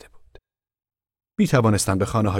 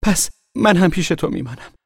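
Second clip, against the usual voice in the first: put-on girl crying out; 12 semitones higher, 4 dB louder than usual. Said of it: "فریاد زد".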